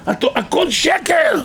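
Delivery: very high, stretched-out cry; retold penitent's shout. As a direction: proclaim it high as if heard from afar. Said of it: "והוא צועק". (צעקת הכרזה גבוהה וממושכת)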